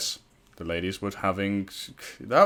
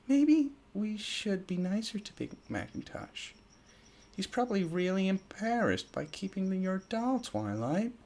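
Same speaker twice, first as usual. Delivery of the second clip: Fluttershy voice; 10 semitones higher, 5 dB quieter than usual. Acted.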